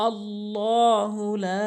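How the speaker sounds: লা stretched to three alif in slow chant; long la. Shown as a demonstration. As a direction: hold লা for three beats. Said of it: "লা অবশ্যই তিন আলিফ টেনে পড়বেন". (লা তিন আলিফ টেনে)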